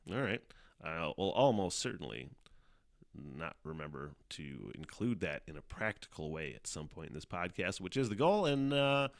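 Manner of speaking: usual speaking voice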